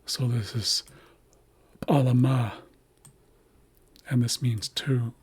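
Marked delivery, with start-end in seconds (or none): 1.9-2.5 alama as pa-la-MA, popped P first; last-syllable stress. Shown as a demonstration. Teacher demonstrates the accent marker, stress on last syllable. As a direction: put the weight on the final MA of "alama". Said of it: "tells us to emphasize the last syllable".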